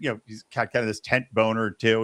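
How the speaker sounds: ordinary delivery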